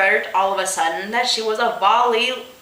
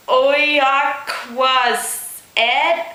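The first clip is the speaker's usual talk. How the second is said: drawn-out, very slow announcement; slow dramatic reveal; 4 semitones higher, much slower than usual. Acted. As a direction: stretch the name out like a big reveal.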